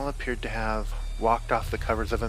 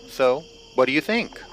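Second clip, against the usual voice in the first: trying to sound casual, pitched up and louder; forced relaxed tone; 5 semitones higher, 7 dB louder than usual. A narrator's reading. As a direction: fake easy calm over nerves. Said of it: "Todd forced what he'd hoped was a relaxed tone".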